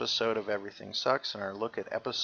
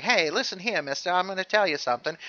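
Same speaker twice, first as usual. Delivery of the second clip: put-on fast, loud old-movie tough guy; forties fast talker; 5 semitones higher, 6 dB louder, faster than usual. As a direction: rattle it off like a forties gangster. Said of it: "people really talk really fast".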